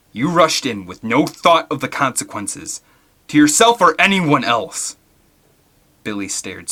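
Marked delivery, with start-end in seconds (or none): 0.1-5.0 loud, rising anger; angry. Acted. Alan scolding reckless Billy, loud and angry. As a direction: let the anger burst out. Alan's anger named next line.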